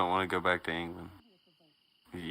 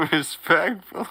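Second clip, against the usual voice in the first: laughing; 10 semitones higher, 5 dB louder, slower than usual. Real, not acted. Laughing catchphrase.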